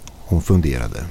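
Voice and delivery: plain reading voice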